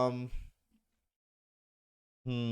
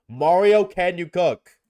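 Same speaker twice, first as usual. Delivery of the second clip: calling out; 5 semitones higher, 11 dB louder, slower than usual. Real, not acted. Streamer calling the Mario TTS voice, loud playful call. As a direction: call out to Mario, much louder.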